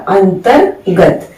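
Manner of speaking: अंतर्गत slow, r snapped with a jerk before ग; slow अंतर्गत with a sharp r jolt; careful slow अंतर्गत, jolt on र्ग. Shown as a demonstration. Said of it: "वो झटके से किया जा रहा है".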